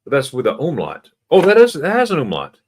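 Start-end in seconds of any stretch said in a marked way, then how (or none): 1.3-2.6 realising. Real, not acted. Realising mistake.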